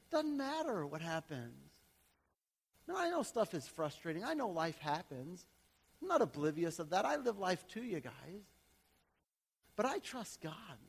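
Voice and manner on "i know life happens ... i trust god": ordinary delivery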